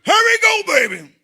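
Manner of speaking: high loud hurry-up call; mock urgent shout; shouted urging. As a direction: yell it like rushing someone out.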